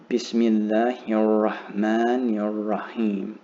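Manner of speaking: slow "Bismillahir Rahmanir Rahim", words linked and doubled letters held; careful teacher's model of "Bismillahir Rahmanir Rahim", slow and joined; joined pronunciation demo. Shown as a demonstration. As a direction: recite "Bismillahir Rahmanir Rahim" slowly as one connected phrase, holding the L and R. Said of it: "So in, in total".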